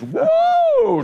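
long high whoop for a getaway; high drawn-out "whoa". Retold or imitated. Imitates car speeding away.

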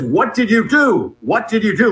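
half-hearted imitation of a furious yell; retold shouting. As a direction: echo his shout without real volume. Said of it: "then he starts shouting".

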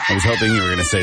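high, fast crying shout; mock wail. Real, not acted. Protests the witch voice.

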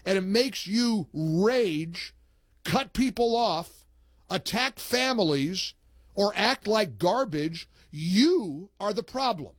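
host reads a listener's post to the audience, slow and pointed; reading a post aloud. Retold or imitated. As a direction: read the post like a scolding sermon. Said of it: "Isn't that a great post".

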